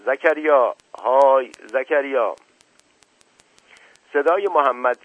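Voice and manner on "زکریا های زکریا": loud call across the water to a fisherman. A narrator's reading. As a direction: shout the name out to the sea. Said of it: "با صدای بلند داد زد". (بلند و کشیده صدا زدن)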